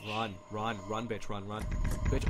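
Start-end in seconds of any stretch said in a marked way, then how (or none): none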